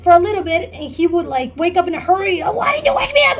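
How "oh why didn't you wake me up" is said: high, hurried imitation of the boyfriend's whine; mock boyfriend panic. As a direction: mimic him waking up late and blaming her, much higher. Boyfriend waking up in a hurry.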